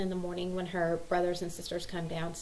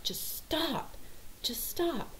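higher, strained mock pleading; exasperated plea; 9 semitones higher, 4 dB quieter, much slower than usual. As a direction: plead wearily with squabbling kids. Mom pleading with arguing kids.

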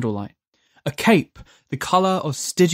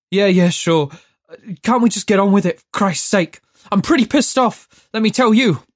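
loud, higher impatient grumble; irritated outburst; 5 semitones higher, 4 dB louder than usual. A narrator's reading. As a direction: Judd snaps at the angel, impatient and loud. Judd irritated at the angel.

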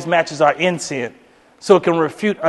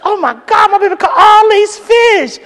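proud gushing wife; 14 semitones higher, 5 dB louder than usual. Retold or imitated. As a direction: drag out the praise like a starstruck wife. Wife bragging on husband.

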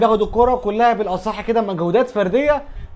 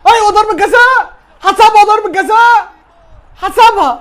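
high, loud excited call; excited shout; 9 semitones higher, 8 dB louder than usual. Real, not acted. Referee gives a penalty.

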